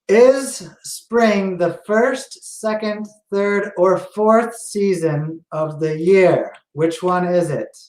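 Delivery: slow quiz question; quiz host, slow. Acted. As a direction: read it slowly like a quiz host. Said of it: "next question here".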